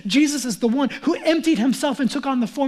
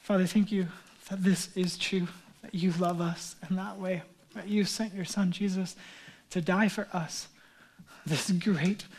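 prayer; 5 semitones lower than usual. Real, not acted. Pastor praying for the congregation, reverent and rising.

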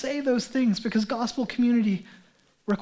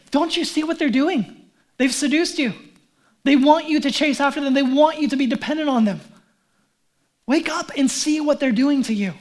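higher, urgent wake-up call; raised-pitch passionate warning; urgent plea; 4 semitones higher, 3 dB louder than usual. Acted. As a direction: plead with them to see it, higher and forceful. Acting Paul pleading with the church.

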